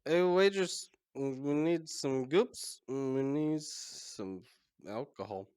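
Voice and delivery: high, drawn-out call for service; Karg yelling out. Acted. Karg calls the waitress for goop, high drawn-out yell. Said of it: "Karg is gonna yell out".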